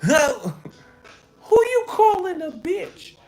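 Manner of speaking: very high, loud mock rap shout. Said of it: "My mom".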